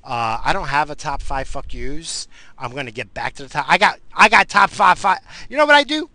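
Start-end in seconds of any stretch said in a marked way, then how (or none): 3.7-6.1 high, loud, tongue-tied outburst; enraged. Real, not acted. Host suddenly remembering his top five list, enraged. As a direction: stumble over the words in mounting rage. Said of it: "I just got so enraged".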